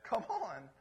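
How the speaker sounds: ordinary talk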